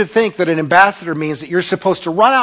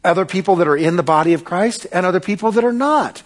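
ordinary talk

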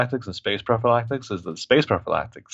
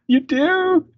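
very high put-on voice, drawn up into a question; high-pitched mock quote; mock impersonation; slower than usual. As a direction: imitate her offering in a silly high pitch. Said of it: "She's like".

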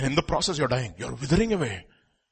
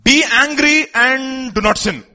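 proclaiming; 10 semitones higher, 6 dB louder, slower than usual. Acted. Scripture quote proclaimed.

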